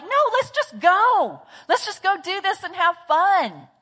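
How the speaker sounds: high, eager urging; impulsive fun-lover. Acted. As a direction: brush off the planning with playful impatience. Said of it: "And the I personality is li".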